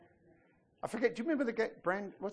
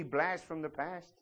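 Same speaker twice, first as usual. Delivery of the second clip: ordinary talk, nothing marked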